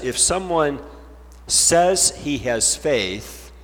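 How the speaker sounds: says drawn out slowly and leaned on; stress on says. Shown as a demonstration. Preacher stressing says to his congregation. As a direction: lean hard on the word says, slowly. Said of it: "emphasize the word says".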